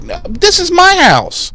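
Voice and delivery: a very high, mock-outraged protest; indignant Anya. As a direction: squeak it out in offended disbelief. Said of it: "Anya's like".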